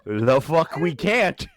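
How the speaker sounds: loud, slow, drawn-out objection; emphatic protest. Acted. Podcaster insisting the joke stays in, emphatic protest.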